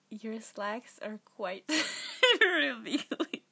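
slow start collapsing into loud laughter; giggly. Real, not acted. Voice actor attempting a silly line, losing it to giggles. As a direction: try to hold the line, then crack up. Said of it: "all, like, giggly".

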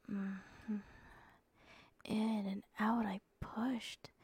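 plain reading voice

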